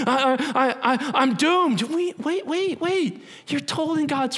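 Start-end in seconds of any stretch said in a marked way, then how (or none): 0.1-1.8 stammering, higher mock panic; a hammed-up stutter of dread. Stammering doomed believer. 1.8-3.2 gently halting a panicking friend, higher; stopping someone. Acted. Cutting off the panic.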